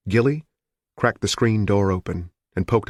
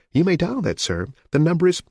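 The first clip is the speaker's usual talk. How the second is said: lightly voiced polite operator, higher; a hint of a young telephone operator, higher; 10 semitones higher than usual. A narrator's reading. Operator answers the caller, lightly voiced and higher.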